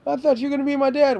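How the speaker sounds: mocking son's whine. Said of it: "mocking son's whine".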